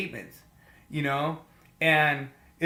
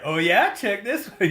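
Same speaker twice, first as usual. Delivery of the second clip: louder, swooping mock comeback; playful one-upping; 5 semitones higher, 5 dB louder than usual. Podcaster imitating his friend's reply, playful one-upping. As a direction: fire back a teasing boast.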